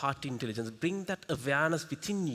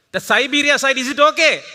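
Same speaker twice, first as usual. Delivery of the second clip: high, loud call across the hall; 9 semitones higher, 14 dB louder than usual. Real, not acted. Trainer calling to the far side of the hall, loud. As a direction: shout it to the back rows.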